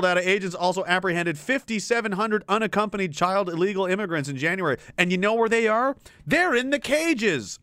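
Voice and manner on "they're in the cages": slow, higher, mocking emphasis; sarcastic drawl. Real, not acted. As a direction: stretch it out with biting sarcasm. Sarcastic reveal.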